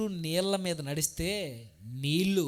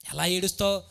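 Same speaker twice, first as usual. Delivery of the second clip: ordinary delivery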